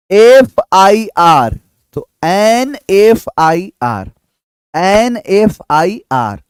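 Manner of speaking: slow, clear एनएफ़आईआर with the article joined on; drawn-out एनएफ़आईआर, as if dictating; slow demo. Shown as a demonstration. Teacher dictating एनएफ़आईआर slowly to students. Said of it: "letter demo".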